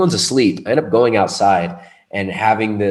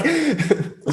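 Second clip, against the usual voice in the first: laughing. Real, not acted. Laughing at himself.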